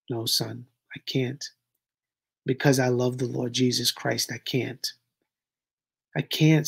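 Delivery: a father begging his son not to marry; pleading father. Acted. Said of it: "That's what I'm doing, y'all. I'm pleading with him".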